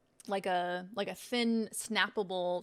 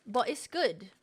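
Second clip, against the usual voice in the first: ordinary talk, nothing marked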